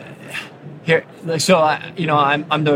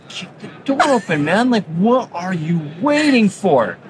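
impatient daughter; 3 semitones higher than usual. Retold or imitated. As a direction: imitate an exasperated kid urging someone on. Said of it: "she's, like, getting impatient".

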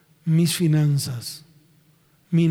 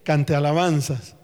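normal talking voice

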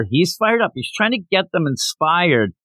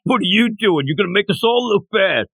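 higher-pitched imitated telling-off; imitating an annoyed old hand; mock scolding co-worker; 4 semitones higher than usual. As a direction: pull the eager newcomer up short. Old worker reins in keen newcomer.

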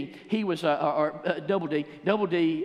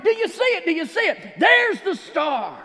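excited shout; 14 semitones higher, 9 dB louder than usual. Acted. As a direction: point and shout in awe. Magi shouting at the star.

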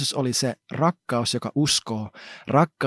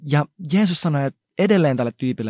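ordinary talk

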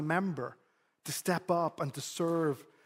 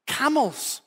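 put-on eager child voice; 8 semitones higher, 3 dB louder than usual. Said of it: "And he goes".

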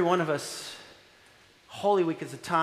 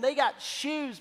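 ordinary delivery

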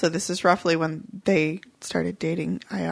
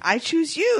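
higher playful "I choose you"; Pokémon trainer call; 8 semitones higher than usual. Acted.

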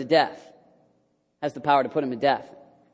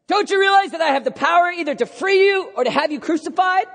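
puffed-up, threatening shout; high, loud, self-important bluster; Pilate; 14 semitones higher, 6 dB louder than usual. Acted. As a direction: puff out the chest and bellow the threat. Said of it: "puffing out his chest a little bit".

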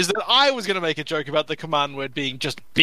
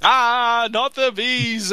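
raised, drawn-out mock cry of panic; Nicolas Cage; 4 semitones higher, much slower than usual. Player fleeing bees into a fountain, drawn-out mock cry. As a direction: wail it slowly in hammed-up alarm. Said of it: "pull a Nicolas Cage".

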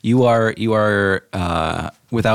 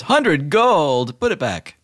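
genie; 8 semitones higher, much slower than usual. Acted. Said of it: "And he says".

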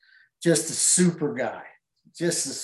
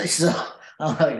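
ordinary delivery

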